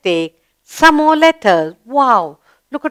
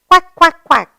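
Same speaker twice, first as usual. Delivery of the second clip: loud, high mock quacks; quacking duck; 7 semitones higher, 4 dB louder than usual. Teacher imitating a duck for the class, high and loud. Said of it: "goes about saying".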